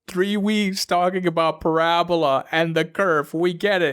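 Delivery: rising, drawn-out exasperation; exasperated rant. Real, not acted. Student complaining to a friend about a dragging class, exasperated. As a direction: vent like you're fed up with the lesson.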